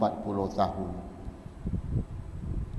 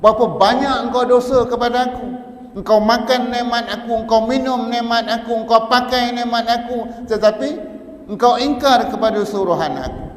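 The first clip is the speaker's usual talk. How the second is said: much louder and higher, fast scolding; thundering rebuke; 11 semitones higher, 9 dB louder than usual. Acted. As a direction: scold sinners with full force. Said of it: "kalau Allah Ta'ala nak jerkah kita". (jerkahan yang sangat lantang dan tinggi)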